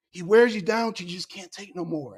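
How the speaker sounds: ordinary talk, nothing marked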